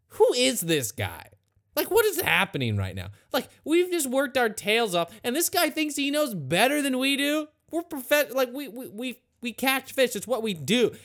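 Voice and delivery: high, worked-up protest; indignant disbelief. Acted. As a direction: act out offended experts, stumbling over words. Acted disciples doubting the stranger.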